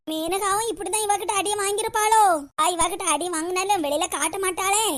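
ordinary delivery

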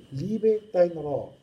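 "liebe dein Ra" slow and loud, drawn out word by word; Hebrew-style reading. Shown as a demonstration. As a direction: intone "liebe dein Ra" slowly as a Hebrew formula. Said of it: "hebräisch".

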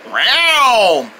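falling cat yowl; cat meow. Acted. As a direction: answer with a cat's meow.

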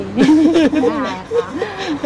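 amused burst of laughter; real, easy chuckling; laughing. Real, not acted. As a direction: laugh it off lightly. Laughing at being taken for mother.

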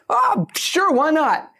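very high, breezy shrug; casual comedian. Retold or imitated. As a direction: toss it off high and carefree. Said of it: "somebody goes".

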